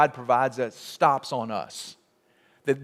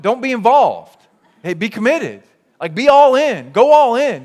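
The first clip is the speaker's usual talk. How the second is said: louder and higher, preaching at full force; fervent exhortation; 5 semitones higher, 8 dB louder than usual. Acted. Preacher urging the congregation to commit, fervent and loud. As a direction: push them hard to go all in.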